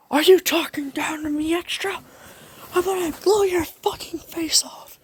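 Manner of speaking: hiss-shout it like a feral bully; Bakugo raspy threat. Acted. Bakugo threatens to blow Izuku's face off, high rasping snarl. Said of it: "how about this?".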